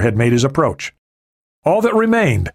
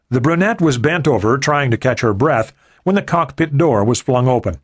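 usual speaking voice

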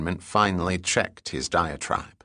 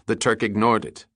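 ordinary delivery

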